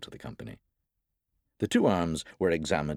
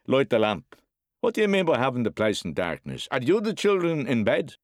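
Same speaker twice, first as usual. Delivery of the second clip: loud, raised, angry demands; domineering drunk father scolding; bullying father's orders; 9 semitones higher, 5 dB louder than usual. Acted. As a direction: bark orders at the boy. Father barks orders at boy.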